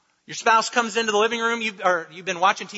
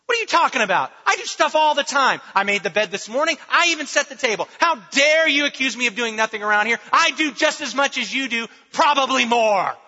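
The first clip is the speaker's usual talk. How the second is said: louder, higher indignant comeback; mock defensive retort; 5 semitones higher, 5 dB louder than usual. Partner to hollering spouse, mock indignant retort. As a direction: snap back like you've been accused.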